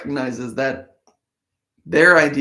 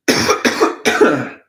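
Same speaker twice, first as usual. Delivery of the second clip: loud dry cough; sick cough; 8 dB louder than usual. Real, not acted. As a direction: cough hard while still sick. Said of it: "Ah, still a little sick".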